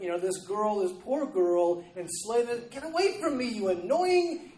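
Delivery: loud, high exasperated rant; annoyed outburst. Acted. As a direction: act out being fed up, voice shooting up. Acting out Paul's annoyance at girl.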